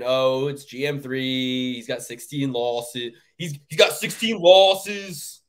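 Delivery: slow, drawn-out Philly voice; dopey drawl in a mock Philly accent. Host imagining Petroski shrugging off his opponent, Philly accent. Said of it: "say it in the Philly voice".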